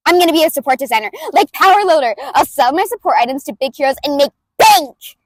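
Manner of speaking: bulldoze him with gleeful energy; brash inventor girl, throaty and loud; loud brutal May voice. Acted. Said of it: "I do it in the back of the throat, and I do it loud".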